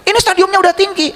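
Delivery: high, indignant protest; sick man angrily rejecting the counsellor's reassurance; angry protest. Retold or imitated. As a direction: push back hard like an offended patient. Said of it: "Marah sama saya".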